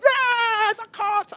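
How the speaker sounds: high, loud panicked cry; panicked kids. Retold or imitated. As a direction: yelp in high alarm at the cops.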